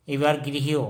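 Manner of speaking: ordinary talk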